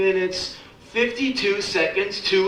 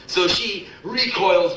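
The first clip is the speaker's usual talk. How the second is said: usual speaking voice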